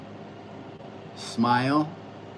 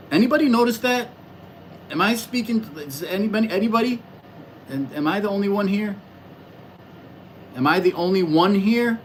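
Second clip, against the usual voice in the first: higher, asking the empty room; asking the chat if anyone is there, higher and puzzled; mock bewildered; 5 semitones higher than usual. Real, not acted. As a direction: pretend to wonder if anyone is listening. No response from the chat.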